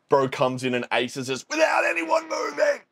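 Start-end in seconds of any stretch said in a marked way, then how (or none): 1.5-2.8 much higher, yelling in disbelief; excited shout. Real, not acted. Fan yelling at the anime's ace, excited shout. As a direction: yell it like the ace just stunned you.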